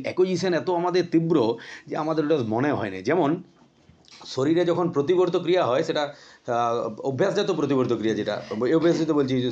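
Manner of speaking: ordinary delivery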